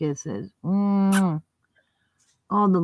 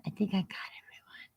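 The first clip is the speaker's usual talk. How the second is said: whisper; 15 dB quieter, much faster than usual. Real, not acted. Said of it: "whispered aside after roll call".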